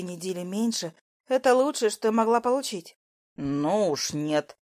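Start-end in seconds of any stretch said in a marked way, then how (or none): 3.4-4.6 low, very slow no; cool, drawn-out refusal; cold refusal. A narrator's reading. Lucas brings her back to earth, coldly. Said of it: "холодно ответил Лукас".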